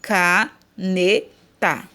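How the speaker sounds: Caneta slowly split as CA, NE, TA; a clear pause after each syllable of Caneta. Shown as a demonstration. Teacher showing correct syllable separation, Caneta in pieces. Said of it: "separado a sílaba corretamente".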